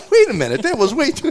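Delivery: loud, high mock protest; imitated startled dad. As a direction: cut in high and loud, taken aback. Retold father's shocked reaction to swearing.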